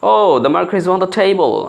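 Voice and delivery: "Oh, the marker is on the table." drawn out slowly; unhurried model sentence, word by word; slow correct model. Shown as a demonstration. Teacher modeling the right English line, slowly. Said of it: "অথবা তারপরে দেখার পরে আমি বললাম যে".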